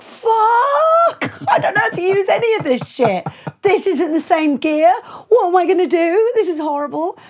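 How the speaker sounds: mock-panicked shriek, rising high and loud. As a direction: squeal in terror at the console. Panicking at the strange console.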